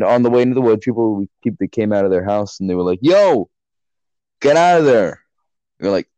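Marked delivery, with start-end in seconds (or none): 3.0-5.2 raised, higher-pitched retold holler; mock neighbour yell. Neighbours shooing teens off their road, mock yell. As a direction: call out a mock warning.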